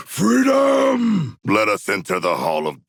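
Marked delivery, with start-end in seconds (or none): 0.1-1.4 high, loud triumphant yell of freedom; battle cry. Acted. Shark warrior yelling a battle cry in loud triumph. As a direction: roar it out as you break free.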